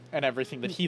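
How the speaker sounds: normal talking voice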